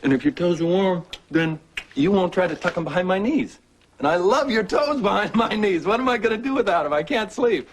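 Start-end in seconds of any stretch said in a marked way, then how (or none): 2.1-7.7 higher, mock-plaintive whine; playful teasing. [name] teasing his wife about her cold toes, mock-plaintive. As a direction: tease her with a mock lament.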